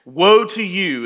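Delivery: relished prophetic denunciation; in-their-face rebuke. Acted. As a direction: proclaim it straight at the accused. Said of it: "Jesus gets in their face".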